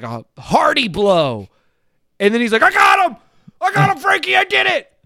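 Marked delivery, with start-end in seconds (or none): 2.6-4.9 very high, loud victory whoop; gloating celebration shout. Acted. Mimicking Jackson bragging to Frankie after a knockdown, gloating shouts. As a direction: crow it as the triumphant winner.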